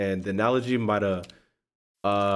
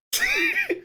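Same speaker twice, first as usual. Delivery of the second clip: short squeal of laughter; high laugh. Real, not acted. Vlogger laughing at the cat clawing him, high squeal.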